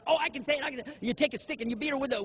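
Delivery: high, quick know-it-all; mock eager answer. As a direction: jump in too eagerly, mocking. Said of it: "somebody else give a question".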